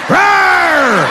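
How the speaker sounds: hammed-up high monster roar. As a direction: roar like a crazed addict. Meth addict rising up.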